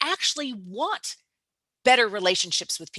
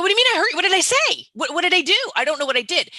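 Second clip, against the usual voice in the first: much higher and louder, flustered disbelief; defensive surprise; 8 semitones higher, 5 dB louder, much faster than usual. Retold or imitated. Person told they hurt someone reacts, startled and defensive. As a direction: sound startled and defensive, as if wrongly accused. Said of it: "And they go".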